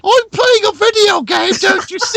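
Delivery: put-on squeaky kid voice. Friend mocking his old video in a squeaky kid voice. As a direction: squeak it out like a little kid gamer. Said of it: "and it's all like".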